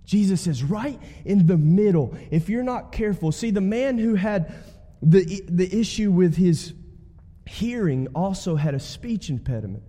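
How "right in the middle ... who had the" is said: usual speaking voice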